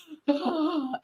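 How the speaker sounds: sharp little gasp; mock gasp of fright; mock scared gasp. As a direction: gasp like a spooked man. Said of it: "he'd be like".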